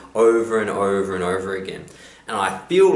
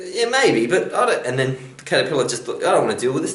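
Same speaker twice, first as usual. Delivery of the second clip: higher, wary critter voice; dismissive caterpillar; 5 semitones higher than usual. Acted. Caterpillar dodging the frog's question, higher and wary. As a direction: brush off the pushy frog.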